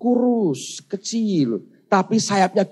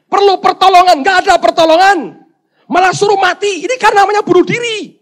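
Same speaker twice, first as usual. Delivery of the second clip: high, indignant rant building louder; enraged tirade; 13 semitones higher, 9 dB louder than usual. Acted. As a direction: pour out the grievance, rising to shouting. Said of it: "Ngamuk orang Kristennya".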